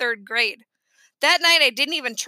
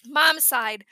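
ordinary delivery

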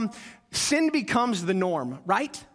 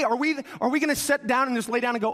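normal talking voice

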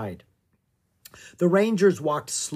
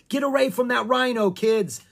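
high, louder urgent shout; shouted warning; 11 semitones higher, 5 dB louder than usual. Acted. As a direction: yell at the kids to get back. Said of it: "Jomo shouted".

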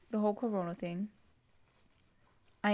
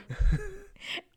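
high laugh; 12 semitones higher, 3 dB louder than usual. Real, not acted. Laughing at her own joke, high burst.

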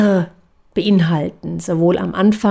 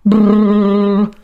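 loose relaxed brrr lip buzz; lip trill; 5 dB louder than usual. Shown as a demonstration. Voice coach demonstrating a relaxed lip trill. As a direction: flutter the lips loosely. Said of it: "Das kann sich dann so anhören".

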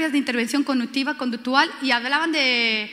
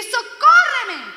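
high, loud, very slow cry of socórreme; exclaimed plea; 10 semitones higher, 9 dB louder, much slower than usual. Shown as a demonstration. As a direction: cry out socórreme with all your strength. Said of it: "porque está entre exclamaciones". (gritar socórreme alto, fuerte y muy despacio)

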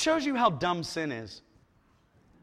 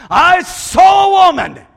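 loud declaration; 10 semitones higher, 16 dB louder than usual. Acted. Samson's first words, acted.

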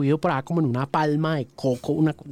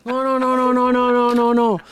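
higher, louder repeated no of someone close to tears; moved, overwhelmed; 9 semitones higher, 4 dB louder than usual. Real, not acted. Host recalling the soldier's call, higher and near tears. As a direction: pour out the emotion as the no's climb. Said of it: "casi me pongo a llorar".

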